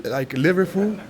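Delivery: mock Liverpool accent on "Liverpool"; Scouse accent. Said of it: "Liverpool is like Liverpool".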